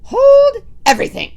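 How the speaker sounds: high loud screech. Acted. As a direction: screech like a trumpet. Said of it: "Her screeching voice echoed".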